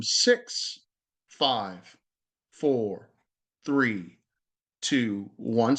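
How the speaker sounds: slow, falling countdown with pauses. Shown as a demonstration. Teacher showing the exhale countdown, slow and falling. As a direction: let each number drop as the breath goes out. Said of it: "I'm gonna say exhale".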